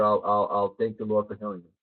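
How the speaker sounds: low, soft, stammering grudging repeat; reluctant sick kid. Retold or imitated. Sick kid repeating mom's words to her, grudgingly low. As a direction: mumble it like a kid humoring his mom.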